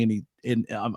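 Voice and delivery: usual speaking voice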